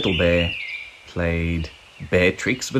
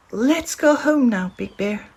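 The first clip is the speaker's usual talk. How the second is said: much higher, small cub voice; a tiny eager bear cub pleading; little bear voice; 13 semitones higher than usual. A narrator's reading. Little Bear's voice in story.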